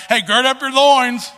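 loud, high country holler; hammed-up country accent, pitched high and loud. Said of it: "I don't know why I'm saying it with a country accent".

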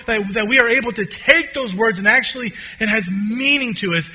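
loud, high, building run of declarations; impassioned preaching. Real, not acted. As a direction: pile up each promise with rising fervour.

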